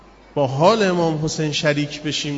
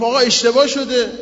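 loud, higher cry of alarm; shouting; 6 semitones higher, 5 dB louder than usual. Acted. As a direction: burst out that there's been a mistake. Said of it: "داد زد".

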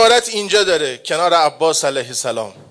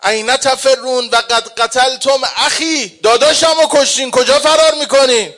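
grieving shout; 5 semitones higher than usual. Acted. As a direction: call after them in anguished rage. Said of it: "ابو عبدالله فریاد زد".

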